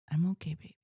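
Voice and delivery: put-on whispered reassurance to a child. Mom whispers to her daughter that she's okay.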